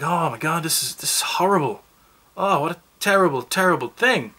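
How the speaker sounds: high melodramatic lament; mock choked up. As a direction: ham up a viewer's heartbreak. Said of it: "get really emotional and choked up".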